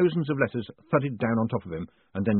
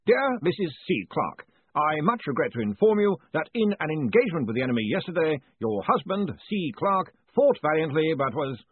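higher-pitched bearer of bad news; official letter voice; 6 semitones higher than usual. A narrator's reading. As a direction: read out a formal condolence letter. Third letter read, official death notice.

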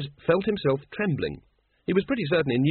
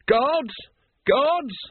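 very high, urgent summons; calling out; 13 semitones higher than usual. A narrator's reading. Once calls his guards to seize the patrician, calling out shrilly. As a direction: call for help down the corridor, pitch way up.

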